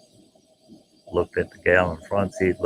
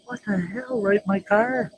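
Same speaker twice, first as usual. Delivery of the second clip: much higher, shocked disbelief; 12 semitones higher, 5 dB quieter than usual. Retold or imitated. Driver stunned by passengers flashing him, high shocked voice. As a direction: gasp it out, stunned.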